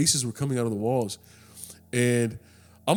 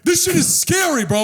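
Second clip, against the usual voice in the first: high, loud, hyped shout; excited shout; 8 dB louder than usual. Retold or imitated. Urging the driver to post the video, hyped shout. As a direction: hype him up to post it.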